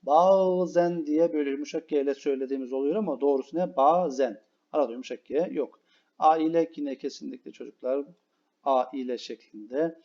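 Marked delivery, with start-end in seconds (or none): none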